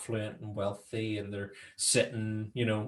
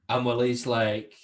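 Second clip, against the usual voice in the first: usual speaking voice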